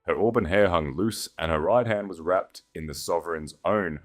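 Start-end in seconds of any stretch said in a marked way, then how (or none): none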